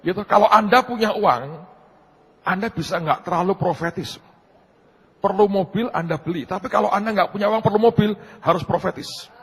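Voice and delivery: high, loud, emphatic sermon point; forceful preaching. Real, not acted. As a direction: drive it home loudly to the congregation.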